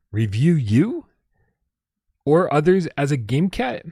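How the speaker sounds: puzzled, slowed-down rising question; baffled repeat. Real, not acted. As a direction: repeat it as if you can't believe it. Repeating the odd tip, baffled.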